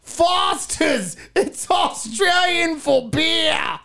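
high, slow Aussie ad-voice shout; Australian accent in a mock beer commercial. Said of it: "involve accents".

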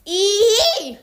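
loud, high squeal of disgust. Real, not acted. Disgust at the candy.

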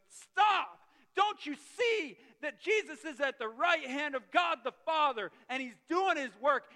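loud, high cry of protest; urgent, full-voiced yell to a crowd; impassioned shout. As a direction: shout it out like a preacher acting a martyr's plea. Acts out Stephen pleading with attackers.

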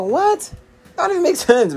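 can't believe what he heard; incredulous. Real, not acted. Reacting to his girlfriend's sandwich demand, incredulous.

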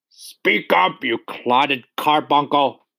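high-pitched, drawn-out insult; angry roar. A narrator's reading. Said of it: "roared the Trunchbull".

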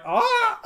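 high-pitched startled "ah"; freaked-out cry. Acted. Hatter with a bottle shoved in his mouth, freaked-out high cry. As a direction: yelp very high in mock fright. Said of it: "he's freaked out".

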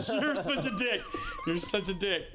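laughing through a mock insult. Real, not acted. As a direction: giggle it out at your friend.

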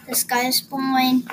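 ordinary delivery, nothing marked

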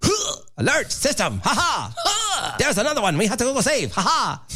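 high, punchy cartoon action-hero shouts; mock a badly dubbed Saturday morning cartoon; Korean cartoon hero. Co-host spoofing a Saturday morning Korean cartoon, hero shouts. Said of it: "a Saturday morning Korean cartoon or something".

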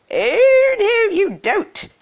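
very high, loud, drawn-out objection; high, swooping screech of refusal; screeching ugly sister. Acted. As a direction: wail it like an outraged sister. Ugly sister refuses to release prince.

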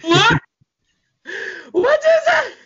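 high, startled question; baffled. Real, not acted. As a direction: squeak out your confusion. Confused by friend's answer.

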